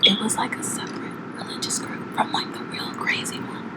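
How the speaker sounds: whispering. Acted. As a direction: whisper the explanation. ASMR segment, describing game.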